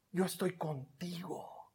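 soft whispered promise. Acted. Preacher voicing God's comfort to a frightened listener, whispered. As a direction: breathe it close to the ear.

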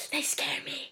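frightened little whisper; acted fear. Neighbor confesses to the reporter about cat people, scared whisper. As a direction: whisper it after a sigh, scared.

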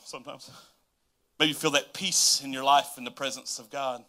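ordinary delivery

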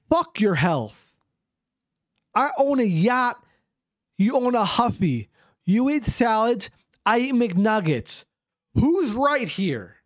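high, slow, hammed-up indignation; mock-angry boast. Yacht owner to Huffy-riding salad eater, mock-angry boast. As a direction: hammer each boast home in mock outrage. Rich eater shouts down the critic.

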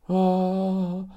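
put-on a boy calling out in fear; scared call. Joey calls for help.

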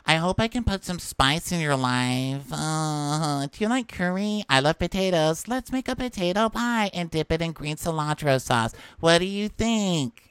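put-on high, drawn-out babyish coo. Mocking a show contestant greeting a bachelor in baby voice. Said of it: "they all have baby voice this season".

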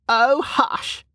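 put-on high-pitched, amused old woman; laughing old woman. A narrator's reading. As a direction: brush her off playfully, pitched high.

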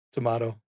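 Tomato said toh-MAH-toh; his own tomato. Shown as a demonstration. Canadian friend demoing his tomato, toh-MAH-toh. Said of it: "Not even t-, tomato".

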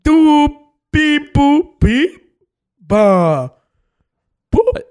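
beep-boop babble. Pretend. Cashier babbles in fake language.

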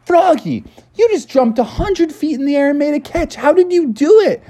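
high-pitched, breathless-with-wonder questioning; acted astonishment, way up in pitch; amazed classmates. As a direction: gush in a very high kid voice.

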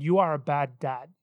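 low whispered-style lie voice, lightly done. Retold or imitated. Satan whispering a lie to a father, low and accusing. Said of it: "repeating this lie in my mind".

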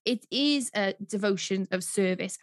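usual speaking voice

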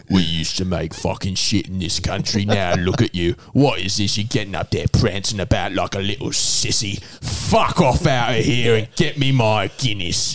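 low, rough, grumbling dad; gruff old father. Retold or imitated. Father scolding his comedian son, low gruff grumble. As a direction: sneer at the son like a bitter old man. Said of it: "And my father's like".

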